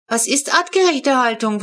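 put-on curious child. A narrator's reading. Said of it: "wollte Flo wissen".

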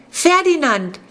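high, loud scream of the name. A narrator's reading. Clara screaming to rouse Ferdinand. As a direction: scream his name to wake him. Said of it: "schrie Clara".

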